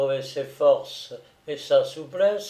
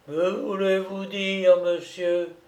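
put-on higher, altered old man's voice, hoarsely crying out; 5 semitones higher than usual. A narrator's reading. Pale old prisoner questioning his captor, hoarse cry. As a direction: demand an explanation in alarm, held on one high note. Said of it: "s'écria le vieillard d'une voix rauque".

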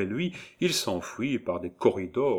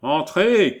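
high, loud nasal call; acted nasal voice shouting from within; 8 semitones higher, 9 dB louder than usual. A narrator's reading. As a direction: call out through the nose. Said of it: "cria une voix nasillarde".

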